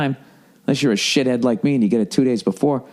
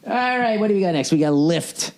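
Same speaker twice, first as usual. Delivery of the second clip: usual speaking voice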